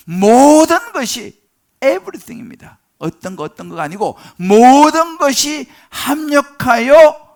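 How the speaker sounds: loud, high, drawn-out preaching cry; emphatic preaching. Real, not acted. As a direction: stretch the key words and belt them out. Preacher hammering the key verse. (힘주어 길게 외치는 설교)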